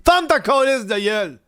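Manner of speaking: mock furious shout at a heckler; angry shout. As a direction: shout the critic down. Snaps at imagined critic.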